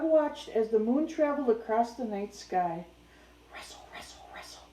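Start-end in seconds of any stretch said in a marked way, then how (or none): 3.5-4.7 hushed rustling sound words; whispered rustle. A narrator's reading. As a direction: whisper it like leaves in the dark. Story sound effect, whispered.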